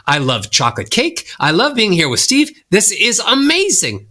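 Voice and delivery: upbeat, rising melody; happy, ascending. Shown as a demonstration. Coach demonstrating ascending scales, sounding happy. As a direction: lift each phrase happily upward. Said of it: "make you sound happy".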